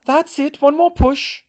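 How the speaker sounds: high, loud shout of encouragement; urgent shout. A narrator's reading. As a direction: shout it out, urging a push. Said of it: "Molly was still shouting".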